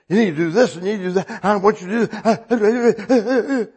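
mock bossy self ordering people about; bossy nagging. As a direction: nag everyone round you, half laughing. Mimics his own bossy nagging.

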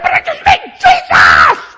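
high, loud, desperate yell at the cop; frantic shouting. Retold or imitated. New convert shouting at the cop. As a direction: shout it like you can't stop. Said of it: "I said".

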